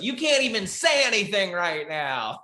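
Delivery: put-on high, gloating kid voice; kid taunt. Kid taunts the speechless comic in a high gloating voice. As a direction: rub it in like a kid.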